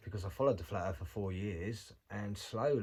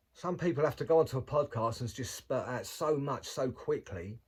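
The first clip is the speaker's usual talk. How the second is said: normal talking voice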